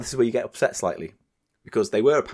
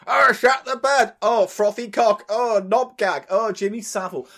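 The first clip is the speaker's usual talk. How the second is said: higher, louder hammed-up catchphrases; mock show voice; 8 semitones higher, 4 dB louder than usual. Mocking the podcast's cheap catchphrase moments. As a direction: bark the catchphrases like a silly show host. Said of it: "a show where it's like".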